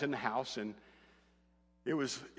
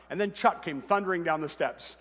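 ordinary talk

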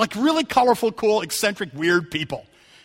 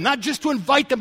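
ordinary talk

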